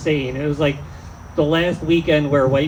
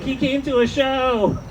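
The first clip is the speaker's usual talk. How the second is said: mock amazement; 8 semitones higher than usual. Mock amazement at dad attending.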